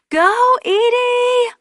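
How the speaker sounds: high drawn-out cheer. Acted. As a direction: cheer her on, stretch it out. Aunt cheering her on.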